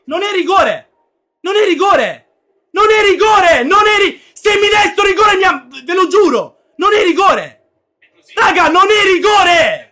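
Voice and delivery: furious shouting protest; explosive italian cursing. Real, not acted. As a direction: rage at the referee. Furious protest against penalty.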